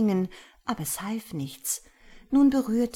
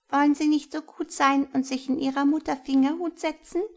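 higher, louder mouse voice; little mouse voice; 8 semitones higher, 5 dB louder, faster than usual. A narrator's reading. The mouse inviting Hjalmar into the thimble, higher and louder. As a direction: ask politely as a tiny mouse. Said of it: "sagte die kleine Maus".

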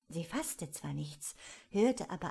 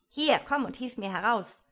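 loud, irritated command; higher, impatient call; annoyed; 4 semitones higher, 8 dB louder than usual. A narrator's reading. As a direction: order help crossly, voice raised. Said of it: "sagte das Kaninchen ärgerlich".

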